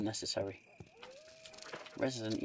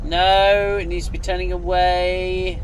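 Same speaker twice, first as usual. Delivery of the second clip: frustrated outburst; 7 semitones higher, 13 dB louder than usual. Real, not acted. Frustrated gamer at puzzle.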